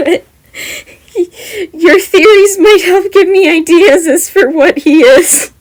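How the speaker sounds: high, loud laughing speech. Real, not acted. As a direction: talk through laughter. Laughing through admission.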